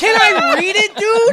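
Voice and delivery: high, loud shout; exasperated demand. Real, not acted. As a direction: yell to get the floor back. Repeats demand over co-host's jabs.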